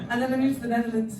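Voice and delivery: usual speaking voice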